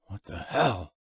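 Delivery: low, soft, breathless whisper. A narrator's reading. Man whispering at the bloody face at the door, low whisper. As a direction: whisper it in shock at the window. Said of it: "whispered Martin".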